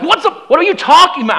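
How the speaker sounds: high fast baffled question; confused classmates. Retold or imitated. Classmates puzzled by a new kid, high baffled question. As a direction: sound totally confused. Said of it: "And they're like".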